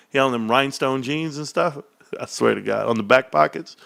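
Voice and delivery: ordinary talk, nothing marked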